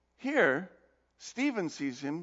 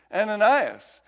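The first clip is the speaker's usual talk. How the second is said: ordinary delivery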